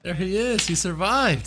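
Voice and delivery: high, excited announcement; excited cheer. Real, not acted. Viewer greeting a character who survived, excited cheer. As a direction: cheer him on as he turns up.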